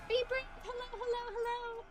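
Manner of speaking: high, sing-song pet talk; high cutesy voice. Real, not acted. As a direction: coo at it like a pet. Greeting pet in game.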